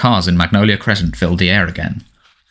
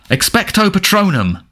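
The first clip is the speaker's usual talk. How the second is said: high, desperate cry of the spell; Harry crying out the spell at the Dementor, high; 10 semitones higher than usual. Acted. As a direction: fling the spell out in fear.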